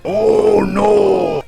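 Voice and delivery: hammed-up anime shout; Joseph Joestar. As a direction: blurt it out like an anime hero. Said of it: "something like Joseph Joestar".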